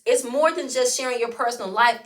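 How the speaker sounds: usual speaking voice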